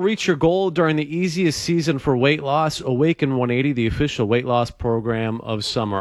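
upbeat, fast radio ad read. Acted. Host reads the sponsor spot to listeners, upbeat. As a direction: sell it like a live sponsor spot. Live ad read at show start.

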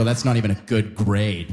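low, dismissive mock voice; snooty friends. Friends mocking his seventy percent, low dismissive sneer. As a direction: drop low and sneer at the grade. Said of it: "They go".

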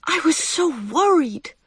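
put-on high, worried child's voice; near tears. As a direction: let it out like a child close to crying. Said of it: "near tears".

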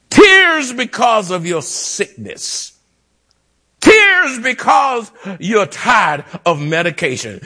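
high-pitched litany, each phrase falling away; impassioned preaching. Real, not acted. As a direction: launch high on each "Tears", then let it fall. Preaches a rising litany of tears.

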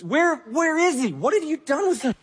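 high-pitched, anguished pleading; acted tearful distress. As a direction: act out a grieving mourner demanding answers. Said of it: "stands outside the tomb crying".

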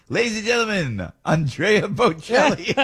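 hammed-up stage announcer; announcing the star to a cruise crowd; mock announcer. Jokes about announcing [name].

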